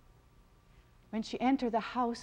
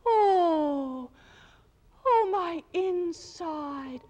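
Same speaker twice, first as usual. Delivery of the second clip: higher, slow aching wail; crying moan; 7 semitones higher, 4 dB louder, much slower than usual. A narrator's reading. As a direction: moan out the pain like a woman in labour. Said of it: "When she cries".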